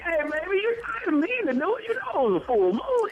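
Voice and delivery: high pleading sweet-talk voice; smooth-talking cheater. Retold or imitated. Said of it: "what if a brother cheats and say".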